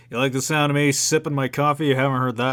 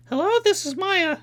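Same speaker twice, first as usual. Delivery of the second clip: put-on much higher, bubbly young girl; chirpy girl voice, pitched way up; Maya; 5 dB louder than usual. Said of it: "my high-pitched girl voice".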